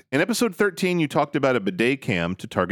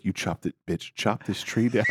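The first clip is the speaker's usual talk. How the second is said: low, softer mock macho order; a hint of a bully husband; low gruff command; 6 semitones lower, 5 dB quieter than usual. Husband barking at his wife about the tree, low.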